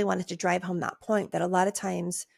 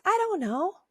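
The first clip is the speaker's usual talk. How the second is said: high-pitched, reflexive brush-off; high, evasive little answer; higher voice; 10 semitones higher than usual. Shown as a demonstration. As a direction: pitch it way up, lifting at the end. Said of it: "And that's a higher voice".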